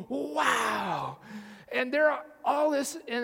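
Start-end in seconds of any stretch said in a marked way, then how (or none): 0.0-1.2 sharp gasp then an awed wow; amazed little gasp. Retold or imitated. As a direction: gasp and breathe out a wondering wow. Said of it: "and you're going".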